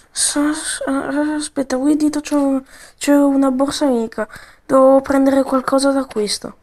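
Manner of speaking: ordinary delivery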